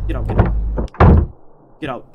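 hushed urgent get out; panicked whisper. Real, not acted. Gamer whispering to himself while fleeing, panicked.

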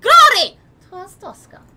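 high, very loud burst on GLORY; demonstrated slogan shout. Shown as a demonstration. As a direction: show how you'd bellow the motto. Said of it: "It's like".

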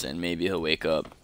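normal talking voice

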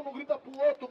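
ordinary delivery

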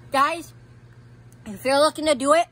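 loud, fast, pained warning; urgent warning. Real, not acted. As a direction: warn them loudly through the burn. Hot chip burning, warning viewers.

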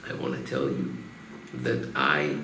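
a hint of a sobbing dying man; low, hushed mock-tearful confession; crying confession. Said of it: "The shochet starts crying".

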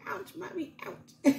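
high mock kid whimper; child's ouch. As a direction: imitate a hurt little boy. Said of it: "He's like".

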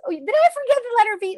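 high, quick burst of real surprise; surprised realisation. Real, not acted. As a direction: catch your own slip out loud, high and fast. Suddenly notices a skipped letter.

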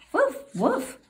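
high playful yapping woofs; puppy bark. Acted. Reading to a child, puppy Biscuit barks high.

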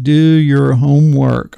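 insistent. Real, not acted. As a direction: drive home the order.

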